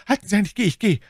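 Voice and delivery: high, urgent cry; frightened bandit yelping his consent; panicked surrender. Acted. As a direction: give in hastily out of terror. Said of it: "indem ihm der Schrecken".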